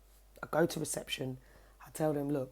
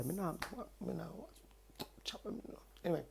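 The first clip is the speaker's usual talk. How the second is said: ordinary talk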